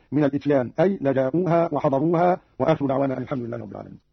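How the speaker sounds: usual speaking voice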